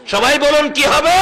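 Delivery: loud, high rallying question; calling for response. Real, not acted. Preacher asking the congregation for the meaning, loud call. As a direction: call out to the whole crowd for an answer.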